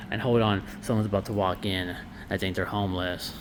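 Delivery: dropping to a low, soft murmur; hushed aside. Real, not acted. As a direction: keep it down so he won't hear. Someone walks in, hushed aside.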